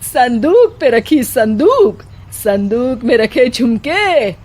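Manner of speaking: hammed-up mushaira recitation by the mother-in-law; mother-in-law reciting shayari. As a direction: declaim the verse like a proud amateur poet. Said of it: "अर्ज करती थी".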